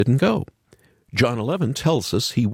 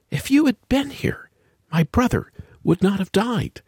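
grieving reproach; 9 semitones higher than usual. Acted. Martha grieving to Jesus.

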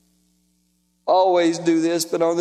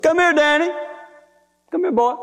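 high, raised call; grandpa's high-pitched summons; calling him over; 8 semitones higher than usual. Acted. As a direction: beckon a grandson insistently.

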